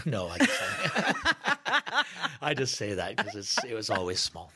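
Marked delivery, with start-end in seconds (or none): 0.7-2.4 high burst of giggles; real laughter. Real, not acted. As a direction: laugh at your own joke. Laughing at own joke.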